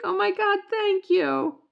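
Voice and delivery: high, loud gushing gratitude; delighted thanks. Retold or imitated. Mom thanking the clerk for the bag, high and excited. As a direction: squeal your thanks. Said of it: "I was like".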